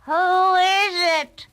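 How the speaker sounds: wolf faking a frail granny; wolf as grandmother. A narrator's reading. Wolf in bed disguised as grandmother, high and slow. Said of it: "asked a voice".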